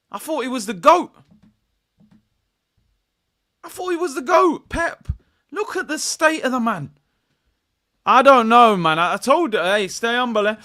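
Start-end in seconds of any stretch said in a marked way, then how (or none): 0.1-1.1 mock disbelief. Disbelief at Pep. 3.6-6.9 mock disbelief. Disbelief at Pep.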